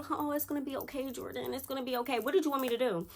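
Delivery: high, soft, sugary mock consolation; mocking the ex by pretending to rub his back; mock comforting. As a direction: coo at him like soothing a baby. Mocking soothing the ex.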